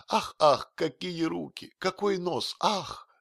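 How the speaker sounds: high-pitched gushing flattery; shower compliments with breathless enthusiasm; provocatively witty. A narrator's reading. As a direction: exclaim in swooning, high admiration. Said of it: "начал вызывающе острить".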